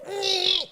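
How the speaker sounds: high bratty squeal, no words; mock tantrum squeal. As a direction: squeal it like a sulking child. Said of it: "you could go".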